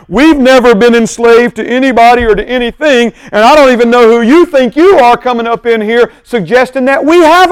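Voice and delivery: loud, haughty, indignant retort; mock entitled crowd; entitled attitude. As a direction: scoff at the idea of needing freedom. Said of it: "their attitude is".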